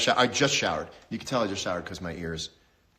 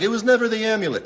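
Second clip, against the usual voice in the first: high, loud, fast hammed-up movie hero; mock movie line; 11 semitones higher, 7 dB louder, faster than usual. Host parodies a sports movie's big line, hammed-up. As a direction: ham up a cheesy movie revelation. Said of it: "What was the movie where it was like".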